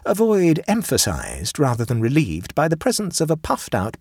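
plain reading voice

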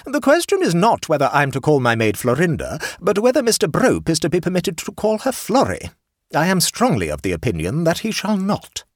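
aunt complaining to the hostess, indignant; stern aunt. A narrator's reading. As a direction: lay down the law like an affronted aunt. Aunt voiced.

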